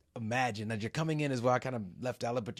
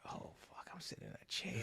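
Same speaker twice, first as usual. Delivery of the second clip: awed whisper; 5 semitones higher, 18 dB quieter than usual. Retold or imitated. First sit in chair.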